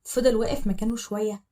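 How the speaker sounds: plain reading voice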